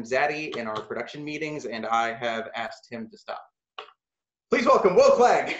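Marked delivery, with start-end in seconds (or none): 4.5-5.6 loud, high welcome to the stage; host calling out. Real, not acted. Host announcing the next storyteller, loud and high. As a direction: boom out the name to the audience.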